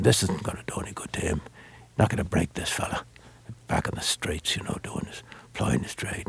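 hushed, breathy whisper of a conspiring detective; retold whisper. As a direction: lean in and whisper it like a secret. Detective's hushed aside continues.

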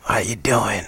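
lightly imitated gruff husky voice; a hint of a gruff, husky rasp. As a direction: mimic a gruff jazzman, understated. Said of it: "I remember him saying something like".

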